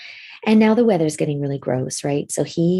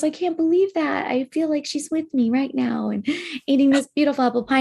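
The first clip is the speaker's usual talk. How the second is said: moved grandpa; 7 semitones higher than usual. Retold or imitated. Voices grandpa moved by the pie.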